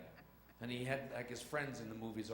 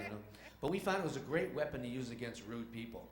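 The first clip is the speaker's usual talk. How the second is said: ordinary talk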